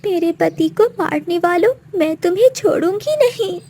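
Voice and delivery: high, tight pitch of anguished anger; grieving vow of revenge. Acted. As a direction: swear vengeance through grief. Widow swears revenge on killers.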